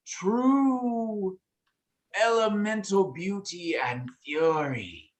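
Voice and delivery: high, slow dragon voice; lofty high voice, savoring each word; high-pitched voice. Acted. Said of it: "the high-pitched voice will say".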